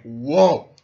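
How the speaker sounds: loud startled whoa; shocked whoa. Real, not acted. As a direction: recoil at the line. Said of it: "I'm like".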